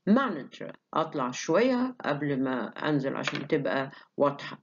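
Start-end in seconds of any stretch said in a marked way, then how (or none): 0.1-0.7 Manager starts high, falls; jump up then fall. Shown as a demonstration. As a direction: leap up on Manager and drop. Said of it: "jump up to a higher level before the fall". (Manager تطلع وبعدين تنزل)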